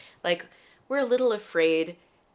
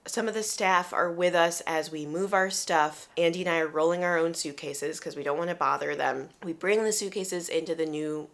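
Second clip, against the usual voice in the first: usual speaking voice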